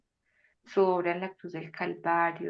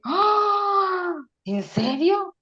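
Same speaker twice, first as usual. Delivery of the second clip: much higher, shouted gasp of disbelief; shocked cry; 13 semitones higher, 10 dB louder, much slower than usual. Retold or imitated. Humans reacting to someone, shrieking in shocked disbelief. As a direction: squeal it like you can't believe it. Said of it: "Que uno dice".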